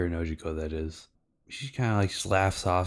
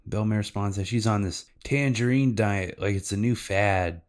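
usual speaking voice

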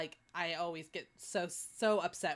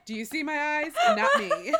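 laughing denial; 7 semitones higher, 9 dB louder, slower than usual. Real, not acted. Denying, laughing.

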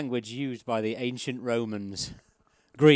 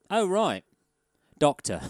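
quiz contestant; 5 semitones higher, 5 dB louder than usual. Retold or imitated.

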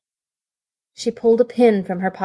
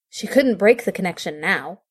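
ordinary delivery, nothing marked

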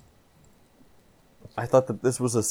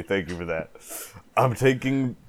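ordinary delivery

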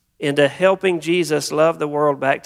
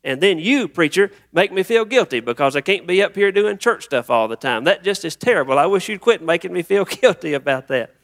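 higher-pitched acted complaint; overwhelmed venting; 5 semitones higher than usual. Exhausted parishioner blaming self and preacher, overwhelmed venting. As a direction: play a stressed churchgoer pouring it all out. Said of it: "begin to just dump everything out".

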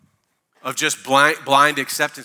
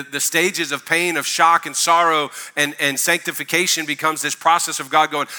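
ordinary talk

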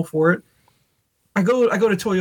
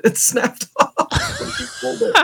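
words squeezed out high through laughter, very slow; giggling while telling it; laughing; slower than usual. Real, not acted. Husband laughing as he tells the handle snapped off.

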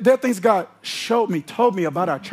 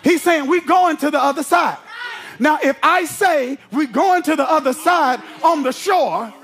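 full-force preaching; 6 semitones higher than usual. Acted. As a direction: preach it hard, building to a shout.

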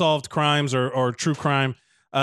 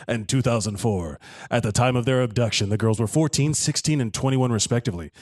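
much lower-pitched spooky narrator; 6 semitones lower than usual. Pretend. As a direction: drop low for a spooky tale. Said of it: "do a spooky voice for this".

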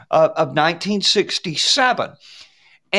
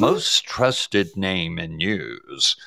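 announcer voice; 11 semitones lower, slower than usual. Retold or imitated. CNN slogan in announcer voice.

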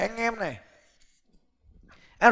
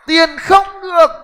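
emphatic call; 7 semitones higher, 11 dB louder, slower than usual. Real, not acted. Emphatic point to audience.

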